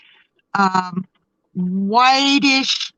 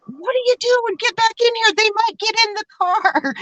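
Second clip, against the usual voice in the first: high-pitched mock panic; Maureen in alarm; 12 semitones higher than usual. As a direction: imitate a frightened friend urging someone back inside. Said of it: "And Maureen's still trying".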